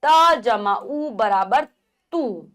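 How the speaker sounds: very slow, each part held apart; slow spelling out. Shown as a demonstration. Teacher slowly spelling a syllable for children. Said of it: "विपरीत दिशा में जाती है".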